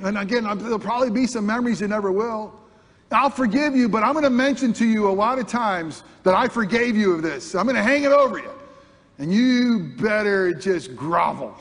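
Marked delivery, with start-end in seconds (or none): 3.1-11.5 higher and louder, lording it over them; mock grudge-holder. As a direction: hang it over them like a threat. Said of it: "grudge-holder quote".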